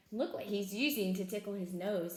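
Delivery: plain reading voice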